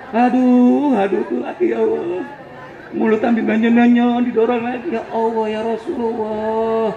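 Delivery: ordinary delivery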